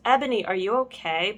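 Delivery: plain reading voice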